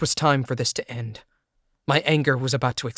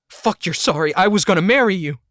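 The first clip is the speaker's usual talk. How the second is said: louder, higher, fast enraged outburst; furious yell; 6 semitones higher, 7 dB louder than usual. Acted. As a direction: spit it out in fast rage. Yelling at cheating fiancée.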